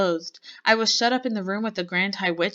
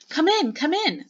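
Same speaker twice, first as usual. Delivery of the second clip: high, loud impatient command; snapped; 11 semitones higher, 6 dB louder than usual. A narrator's reading. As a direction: snap it out like an irritated witch. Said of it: "snapped the Grand High Witch".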